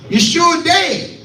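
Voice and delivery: drawn-out pulpit shout; emphatic call. Real, not acted. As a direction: call it out slowly to the church. Preacher drives the point home.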